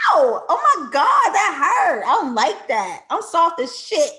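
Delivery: loud hammed-up yelp, pitch jumping high; a playful comic howl of pretend pain; mock-hurt yelp. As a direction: play wounded and delicate, over the top. Playfully acting hurt after Mara's quote.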